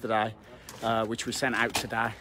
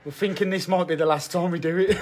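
normal talking voice

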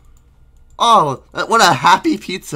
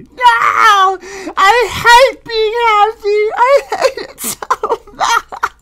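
very high, loud sobbing cartoon yell; wailing Screen Lady; 7 dB louder, slower than usual. Screen Lady refuses to be happy, high loud wailing. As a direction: scream your hatred through tears, way up high.

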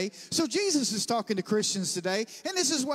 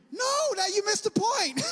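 high, raised-voice retold protest; mock protest; 10 semitones higher, 4 dB louder than usual. Preacher replays stopping the classmate who gives up, high mock protest. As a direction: call it out high, as if mid-laugh.

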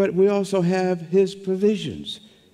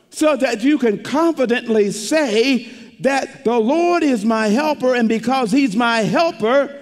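rising preaching; 5 semitones higher than usual. Acted. Preacher lifting the congregation toward confident faith, fervent. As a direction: build the fervour, lift the voice.

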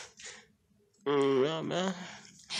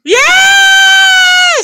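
long, high shouted cheer; very high, loud, drawn-out whoop; delighted cheer; 16 dB louder than usual. Real, not acted. Cheers child saying full name.

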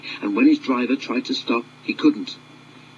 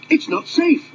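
very high, raised foreman's call; shouting foreman; 3 dB louder than usual. Acted. Foreman warns the driver the ground is unsafe, high. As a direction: warn them urgently. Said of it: "he shouted".

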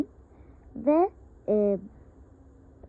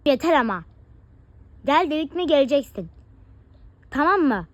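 louder, fed-up mother's order; angry scolding; 6 dB louder, faster than usual. Acted. Mother loses patience with her defiant daughter, loud scolding. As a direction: snap that enough is enough.

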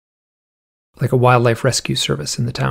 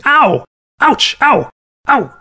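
high, louder imitated "ow" cries; mock pained yelps; 12 semitones higher, 4 dB louder, much slower than usual. Veterinarians getting bit by the owl, mock pained yelps. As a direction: yelp in short, high bursts, imitating someone getting bitten.

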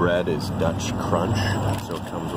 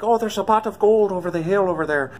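mock stage-Irish accent, much higher and louder; over-the-top Irish accent; 14 semitones higher, 6 dB louder than usual. Mocking the American fake Irish voice, hammed-up Irish accent. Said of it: "over-the-top Irish accent".

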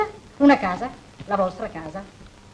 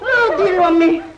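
tearful outburst; 7 semitones higher, 7 dB louder than usual. Acted. Mother joins the marital quarrel.

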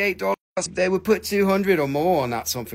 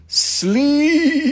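high, drawn-out sleeepy with too many E's; squeaky, stretched-out sleepy; overdone sleepy; 9 semitones higher, 5 dB louder than usual. Shown as a demonstration. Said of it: "That's too many E's".

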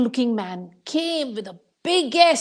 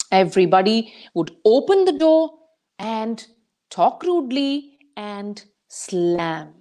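usual speaking voice

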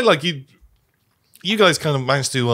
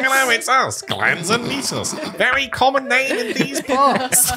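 squeaky rat voice; 12 semitones higher than usual. Acted. [name] voices the rats.

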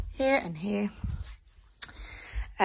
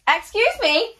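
high, loud "excuse me"; sudden high-pitched interjection; loud exclamation; 12 semitones higher, 7 dB louder, slower than usual. Real, not acted. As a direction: burst out, pitch leaping up. Breaks off her sentence in outburst.

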